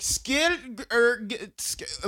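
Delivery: high, broken German attempt; mock German stuttering on consonants; struggling German accent. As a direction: stumble over squirrel like a German speaker. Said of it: "trying to say the word squirrel to German people".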